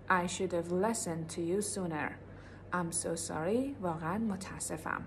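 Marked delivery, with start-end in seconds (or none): 0.1-3.8 slow model sentence. Shown as a demonstration. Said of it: "بگین I'm".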